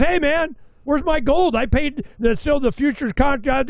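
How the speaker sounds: high, indignant complaining voice; upset investor. Retold or imitated. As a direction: whine like a customer demanding his gold back. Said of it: "He goes".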